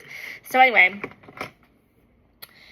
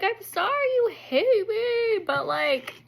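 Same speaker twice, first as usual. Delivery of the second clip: high, drawn-out whine; mock tearful apology; 11 semitones higher, slower than usual. Wife apologizing to husband, mock tearful whine. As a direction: whimper it slowly, hammed-up.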